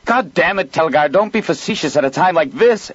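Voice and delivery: loud, high exasperated cry; frantic leader crying out at his pilot. A narrator's reading. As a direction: bark it out in frustration. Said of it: "Ezra cried".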